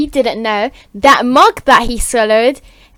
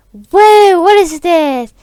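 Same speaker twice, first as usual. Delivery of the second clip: high, loud wonder; amazed; 8 semitones higher, 4 dB louder, slower than usual. Acted. Everyone just turned into fairies, amazed exclamation. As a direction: exclaim it in acted astonishment, pitched up. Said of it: "everyone's like".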